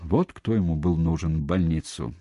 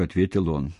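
ordinary delivery, nothing marked

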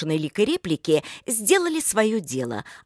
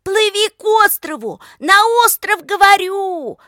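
high, loud insistent holler; shouting; 14 semitones higher, 10 dB louder than usual. A narrator's reading. Lika directing Pavel to the island, high insistent shout. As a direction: yell directions across the water. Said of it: "продолжала кричать Лика".

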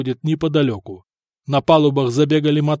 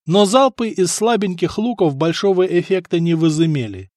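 ordinary delivery, nothing marked

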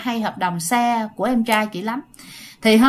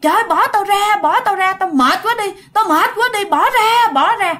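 high, loud pleading yell to be let go; retold desperate yelling; 9 semitones higher, 3 dB louder than usual. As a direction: shout it like a man being dragged off. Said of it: "mọi người cùng nghe rằng". (người chồng la làng bị ma kéo, giọng cao và to)

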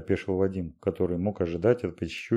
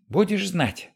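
angrier repeat; 11 semitones higher, slower than usual. A narrator's reading. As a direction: scold it harder the second time. Repeat, louder.

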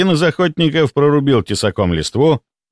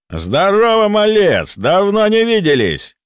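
old hunter, beaming, voiced higher; cheerful greeting; 10 semitones higher, slower than usual. A narrator's reading. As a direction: greet the lad with a broad smile. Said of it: "Расплылся в улыбке Меткий".

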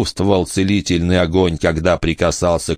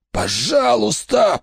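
high, drawn-out begging of a wounded man; anguished, high-pitched "please"; desperate plea; slower than usual. A narrator's reading. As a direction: plead for your life, stretched and high. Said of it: "прошептал Брэк".

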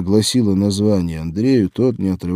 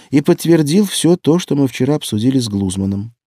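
higher, louder animated instructions; urgent explaining; 6 semitones higher, 5 dB louder than usual. A narrator's reading. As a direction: lay out the request insistently. Маша торопливо просит друга о помощи. (оживлённо, с напором)

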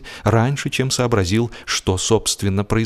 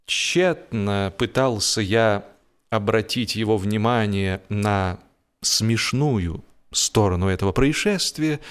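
plain reading voice

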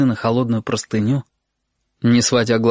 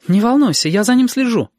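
высоко и громко, с трудом сдерживаясь; успокаивай, скрывая страх; наигранное спокойствие; 12 semitones higher, 4 dB louder than usual. Acted. Сашка успокаивает через дверь. (успокаивает маму через дверь)

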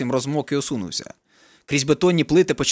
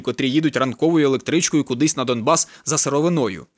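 ordinary delivery

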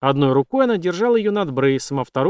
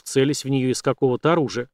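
usual speaking voice

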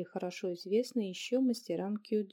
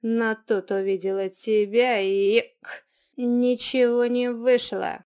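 put-on slurring drunk troll, getting louder; 6 dB louder, slower than usual. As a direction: drag it out like a drunk brute. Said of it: "Тролль пьяно икнул".

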